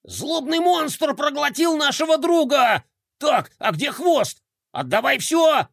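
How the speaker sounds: high, frantic hysterical yell; hysterical shouting. A narrator's reading. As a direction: scream it in a panic. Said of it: "Истерично закричал Бублик".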